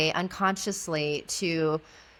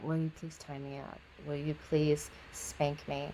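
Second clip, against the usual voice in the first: much softer, hesitant hush; almost a whisper; 2 semitones lower, 9 dB quieter than usual. Retold or imitated. Client begging his mistress, almost whispered. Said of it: "like, almost like a whisper".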